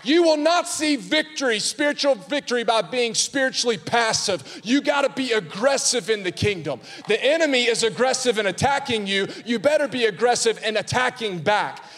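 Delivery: high, loud, driving preacher voice; rally the church to fight back; fiery preaching. Acted. Preacher to congregation, full-force fiery preaching.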